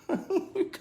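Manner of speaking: sudden high-pitched laugh; high burst of laughter. Real, not acted. Pizza company rejected his idea.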